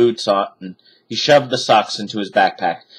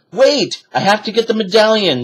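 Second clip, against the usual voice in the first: shouted; 11 semitones higher than usual. A narrator's reading. Boy shouts to his sister, urgent shout. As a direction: call back urgently. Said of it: "shouted Jack".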